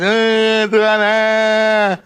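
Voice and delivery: drawn-out fake wailing, imitated; mock crying. Preacher mimicking hollow weeping before God, mock wail. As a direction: imitate someone crying just for the noise of it. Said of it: "jangan nangis cuman bunyinya doang. Ada orang nangis".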